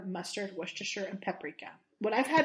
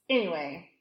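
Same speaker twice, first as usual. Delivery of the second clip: ordinary talk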